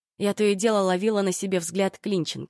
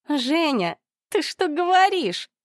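high, slow shrill plea; pleading; 10 semitones higher, 3 dB louder, slower than usual. A narrator's reading. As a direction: beg him in disbelief. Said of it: "взмолилась Ольга". (высокая отчаянная мольба)

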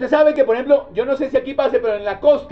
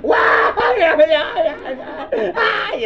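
slow, drawn-out ay ay ay wail; hammed-up funeral wail; mock wailing shout; 8 semitones higher, slower than usual. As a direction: howl like a coastal mourner. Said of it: "Eso gritan feo".

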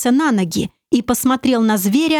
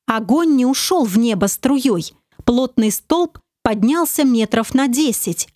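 ordinary delivery

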